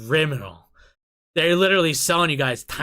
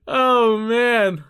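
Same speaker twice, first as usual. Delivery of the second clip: loud, high, winding down from laughter; giddy relief after a laughing fit; real post-laugh exclamation; 9 semitones higher, 8 dB louder than usual. Real, not acted. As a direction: let it out after cracking up.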